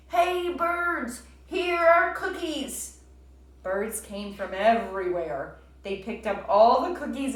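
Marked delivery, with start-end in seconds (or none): 0.1-3.0 high, loud slow call; loud shout. A narrator's reading. Frog shouts to the birds outside, loud and slow. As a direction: call out to the whole sky. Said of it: "He shouted in a loud voice".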